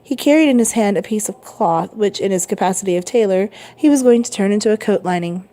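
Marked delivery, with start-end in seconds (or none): none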